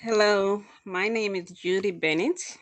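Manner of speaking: ordinary delivery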